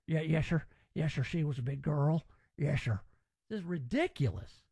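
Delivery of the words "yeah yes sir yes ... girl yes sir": lightly mocked Southern accent, lower voice; Jodie Foster. Host mocking Silence of the Lambs, fake Southern accent. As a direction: parody a bad Southern film accent, pitched down. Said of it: "what Jodie Foster was doing".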